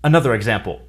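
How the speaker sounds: ordinary delivery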